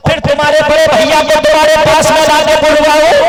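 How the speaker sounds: loud, driving sermon cadence; full-force preaching. Acted. Preacher urging the gathering to listen, booming. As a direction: preach it hard to the crowd.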